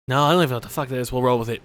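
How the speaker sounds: ordinary talk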